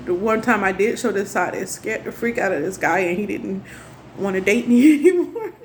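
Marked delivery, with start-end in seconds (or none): 4.2-5.5 laughing. Real, not acted. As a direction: say it through a rising laugh. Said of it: "I'm laughing now".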